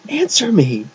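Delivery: very high, insistent shout. A narrator's reading. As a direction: shout the order, out of patience. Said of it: "she shouted".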